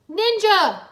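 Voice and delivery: high, loud call; cry for help. A narrator's reading. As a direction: call out under attack. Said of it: "Wu cried".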